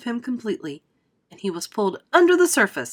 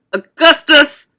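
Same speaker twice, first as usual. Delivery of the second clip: loud call, less high than a mother's; father's cry; 8 semitones higher, 12 dB louder, slower than usual. A narrator's reading. Father cries out to his son, loud. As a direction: cry out firmly and loudly for your son. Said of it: "cried Mister Gloop".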